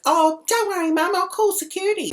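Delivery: very high, loud mocking girl voice; squeaky mock-helpful daughter; young woman imitation. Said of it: "So then the young bird goes".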